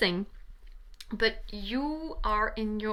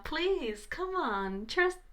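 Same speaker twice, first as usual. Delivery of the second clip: exasperated pleading; 7 semitones higher than usual. Real, not acted. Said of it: "I'm frustrated right now".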